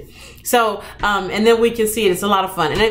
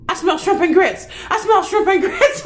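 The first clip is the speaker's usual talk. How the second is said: put-on higher kid voice, eager; daughter sniffing out dinner; 9 semitones higher than usual. Imitating her daughter walking in from school, higher and eager. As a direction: play the fourth grader catching the smell, giggling. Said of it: "she's like".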